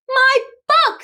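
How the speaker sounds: very high, loud cry of joy; joyful yell. A narrator's reading. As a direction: burst out delighted. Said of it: "Stella yelled".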